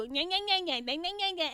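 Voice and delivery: high, loud taunting nya-nya; sing-song it like a nagging woman; teasing schoolyard nya-nya; mock nagging chatter. Mocking women nagging on and on.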